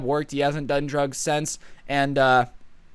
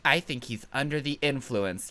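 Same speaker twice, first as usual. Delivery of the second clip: a lightly voiced prissy classmate, a bit softer; mild mock of a know-it-all tattling; hint of a snitching teacher's pet; goody two-shoes; 3 semitones lower, 7 dB quieter than usual. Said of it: "the goody two-shoes".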